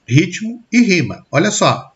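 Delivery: ordinary talk, nothing marked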